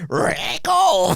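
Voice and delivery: put-on trying an Australian accent and cracking up; laughing Australian attempt. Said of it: "Try to do an Australian accent".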